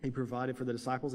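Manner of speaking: ordinary talk, nothing marked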